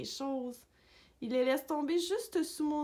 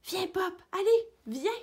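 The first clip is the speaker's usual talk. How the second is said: eager higher-pitched call; 8 semitones higher, 6 dB louder than usual. A narrator's reading. Roquette calls Pop into the pond, high calling voice. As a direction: call a pal over, high and lively. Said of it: "Roquette qui m'appelait".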